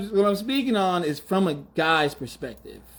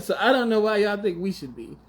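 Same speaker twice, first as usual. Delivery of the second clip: ordinary delivery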